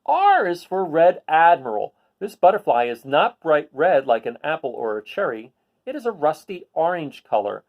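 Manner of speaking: picture-book read-aloud voice; reading to children. Acted. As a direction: read it to a class of kids.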